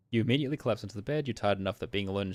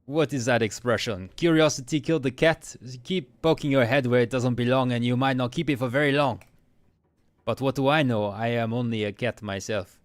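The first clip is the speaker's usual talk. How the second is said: louder, hammed-up French accent, menacing cat; Frou Frou, French accent; 2 semitones higher, 6 dB louder than usual. Cat warning a nosy player, theatrical French accent. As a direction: threaten in a terrible French accent, slowing at the end. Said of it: "for my terrible accent".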